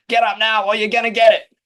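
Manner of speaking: high, loud wake-up shout; yelling across the room. Retold or imitated. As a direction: bark at a sleepy child. Mum waking kid.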